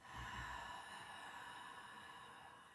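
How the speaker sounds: long soft throaty exhale; ujjayi breath. Shown as a demonstration. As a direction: breathe out slowly through a slightly narrowed throat. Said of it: "breathe out with a".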